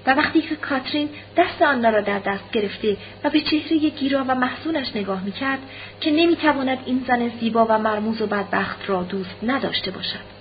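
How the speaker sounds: plain reading voice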